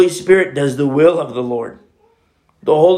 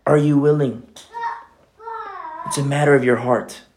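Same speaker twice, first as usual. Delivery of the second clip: ordinary talk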